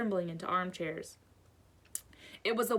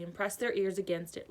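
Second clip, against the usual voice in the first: usual speaking voice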